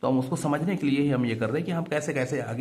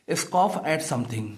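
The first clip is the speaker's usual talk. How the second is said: slow dictation; 2 semitones higher, much slower than usual. Shown as a demonstration. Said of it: "यानी कि scoff का मतलब होता है".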